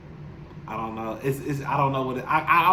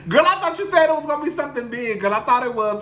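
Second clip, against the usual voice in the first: higher, faster, mocking girlfriend; lightly voiced sassy friend teasing; 9 semitones higher, 4 dB louder, faster than usual. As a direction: rib her like a friend who called her bluff. Imagined viewers teasing her.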